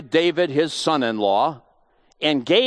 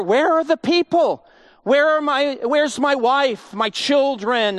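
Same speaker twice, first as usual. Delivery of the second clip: distressed wondering; 11 semitones higher, 3 dB louder than usual. Pretend. David crying out for his missing family, anguished and high.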